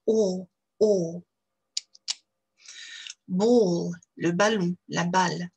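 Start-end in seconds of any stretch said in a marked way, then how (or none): 0.1-1.2 "O" long, rounded, far back. Shown as a demonstration. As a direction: round the lips, hold the back O. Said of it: "à l'arrière de la bouche".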